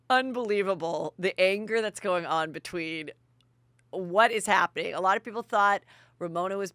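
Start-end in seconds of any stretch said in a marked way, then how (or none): none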